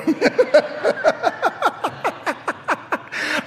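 big, high self-mocking laugh; long high laugh. Real, not acted. Pastor laughing at losing his boast, long and high. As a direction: laugh hard at yourself.

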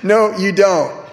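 slow, drawn-out mock reply; wife's retort. Wife answering his boast, slow mock retort. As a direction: imitate the wife shooting him down, slowly. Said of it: "It's like".

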